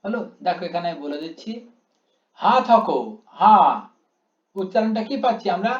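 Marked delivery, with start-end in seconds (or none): 2.4-3.6 "হা" held long and loud; drawn-out syllable. Shown as a demonstration. Teacher reciting a Vaishnav verse, long-drawn "হা". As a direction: stretch the vowel of "হা" before "থকো". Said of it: "হা অনেকটা টান দিয়েছি এখানে আমি".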